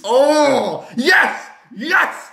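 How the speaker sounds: high, loud yes cheer; ecstatic cheer. Real, not acted. Fan cheering the win again, high loud cheer. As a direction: blurt out joy.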